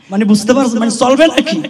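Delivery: ordinary talk, nothing marked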